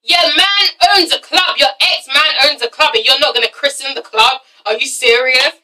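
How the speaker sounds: normal talking voice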